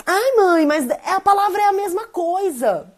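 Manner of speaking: put-on high, loud child's protest; whining child. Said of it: "Ele falava".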